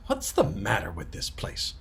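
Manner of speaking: plain reading voice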